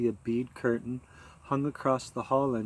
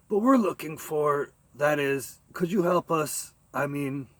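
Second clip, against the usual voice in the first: uncertain; 4 semitones higher, 5 dB louder than usual. Acted.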